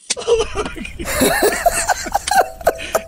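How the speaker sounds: high, drawn-out cackle; helpless high-pitched laughing fit; laughter. Real, not acted. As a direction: crack up at your own punchline. Comedian cracks up at own punchline.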